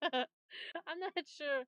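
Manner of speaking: laughing. Real, not acted. Laughs while unsure.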